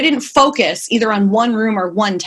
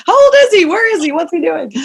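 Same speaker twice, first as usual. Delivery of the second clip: high, loud mock panic, searching; startled parent suddenly realizing how much time has passed; a mother jolted, calling out where did the time go; frantic disbelief; 9 semitones higher, 5 dB louder than usual. Mother panicking at baby growing fast.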